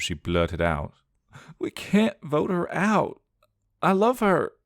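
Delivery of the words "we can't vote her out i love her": sobbing blurt. Acted. Sandra confessing to her brother, high and tearful. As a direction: blurt it out between sobs. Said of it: "In between her sobs".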